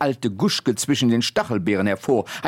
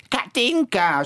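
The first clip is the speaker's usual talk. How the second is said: much higher shrieking call; screeching old woman; 10 semitones higher than usual. Acted. Old Guschke shrieking for the girl from the house. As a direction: yell the name like a cross old woman. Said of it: "schrie die alte Guschke".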